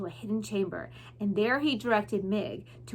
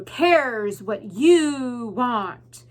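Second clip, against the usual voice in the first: very slow, loud, cold; drawn-out cruel rat; 7 dB louder, much slower than usual. Acted. Roscoe crushing Mig's hope, slow cold sneer. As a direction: stretch each word out to wound.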